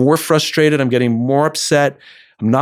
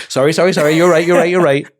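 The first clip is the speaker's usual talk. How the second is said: higher-pitched, hurried mock backdown; hasty apology; 4 semitones higher, 3 dB louder, faster than usual. Listener caught invalidating someone's story, hastily apologetic. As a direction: backpedal in repeated little apologies.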